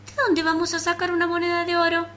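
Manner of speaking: indignant disbelief. Acted. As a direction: cry out in disbelief. Louse indignant.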